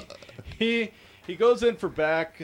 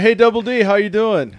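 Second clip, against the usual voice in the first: loud, higher retold phone hello; loud hearty greeting; 6 semitones higher, 10 dB louder than usual. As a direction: call out to a groggy friend. Cheerily greeting groggy friend on phone.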